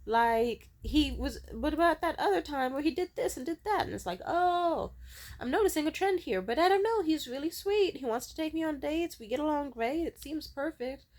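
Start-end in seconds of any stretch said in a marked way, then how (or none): 0.1-3.9 higher, louder inner voice butting in; pointed reminder, pitched up; nagging intuition. Acted. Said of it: "my intuition would be like". 4.2-11.1 much higher, hesitant past-self voice; high-pitched self-convincing; mock wishful reasoning. Acts out her doubting past self.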